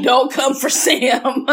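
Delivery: slow, laughing high warning. Real, not acted. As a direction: warn her through a laugh.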